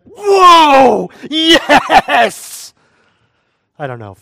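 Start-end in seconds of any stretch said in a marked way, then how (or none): 0.0-2.7 much higher, louder ecstatic whoop; excited Adam. Pretend. Adam jubilant at first seeing woman, whooping. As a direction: shout it with pure joy. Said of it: "he's like".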